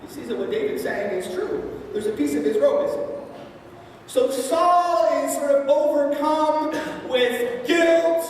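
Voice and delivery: ordinary talk, nothing marked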